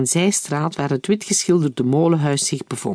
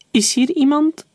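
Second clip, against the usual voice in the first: high, louder call into a dark room; 12 semitones higher, 4 dB louder than usual. A narrator's reading. Daniel calls into the dim mill, high and louder. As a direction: call out into the silence, unsure. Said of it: "riep Daniel".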